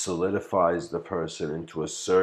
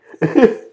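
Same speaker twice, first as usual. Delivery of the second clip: loud high laugh; 14 dB louder than usual. Real, not acted. Laughing after "not joking.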